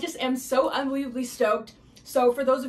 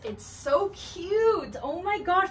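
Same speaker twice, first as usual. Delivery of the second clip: much higher, slow drawn-out gush; gushing delight; 7 semitones higher, much slower than usual. Real, not acted. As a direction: stretch out cute in pure delight. First sight of dress on.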